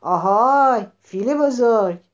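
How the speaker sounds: loud, stretched-out hail; rabbit's ringing story-book shout. A narrator's reading. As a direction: call out to a far-off listener. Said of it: "دوباره فریاد زد".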